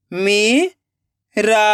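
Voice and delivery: Meera split into मी, long pause, रा; rising मी, then रा alone; syllable by syllable. Shown as a demonstration. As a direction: sound out Meera very slowly in two parts. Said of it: "एक एक अक्षर का उच्चारण करना है".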